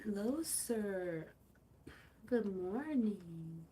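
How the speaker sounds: tender slow hello to a purring cat; soft greeting. Real, not acted. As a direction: greet the sleepy cat gently and slowly.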